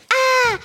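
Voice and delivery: short, high-pitched "Ah!" scream; high yelp of fright; acted scream. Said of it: "Je commence à crier".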